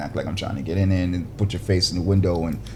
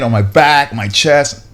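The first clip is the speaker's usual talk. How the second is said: normal talking voice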